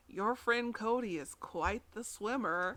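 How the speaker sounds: slow, quiet, creepy delivery; creepy dad. Retold or imitated. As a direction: say it creepily, slowly and softly. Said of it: "delivers this line so creepily".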